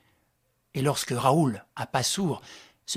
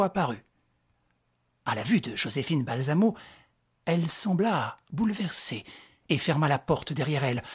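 plain reading voice

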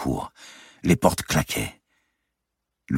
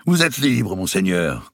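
a pompous official voice, pitched higher; mocking the captain; 6 semitones higher, 3 dB louder than usual. Robert mimics the Châtelet captain releasing him. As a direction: parody the jailer's formal announcement. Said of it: "imitant le capitaine du Châtelet".

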